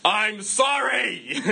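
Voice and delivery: loud, drawn-out, insincere apology; sorry in wrong tone. Acted. Preacher mimicking a botched apology to a partner, wrong tone. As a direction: say sorry as if it's anything but. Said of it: "it's been in the wrong tone".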